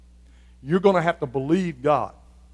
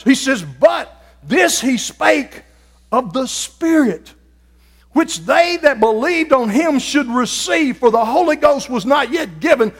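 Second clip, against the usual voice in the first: full-force preaching; 10 semitones higher, 8 dB louder than usual. Acted. Preacher rousing the congregation to seek the Spirit, full-force shouting. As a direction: shout the gospel to the whole church.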